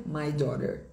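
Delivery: American "my daughter", tapped T, final R; sotaque americano. Shown as a demonstration. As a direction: say "daughter" as "daw-der", American. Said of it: "no inglês americano fica". (sotaque americano, T batido e R final)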